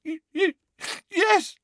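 very high stammered gasp; girl gasping out a fake confession. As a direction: gasp it out in mock fright. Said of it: "gasped Hermione".

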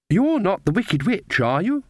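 put-on high, slow child's question; little girl. A narrator's reading. Child questioning witches met in the forest, childlike. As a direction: ask it warily as a small child. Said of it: "she said".

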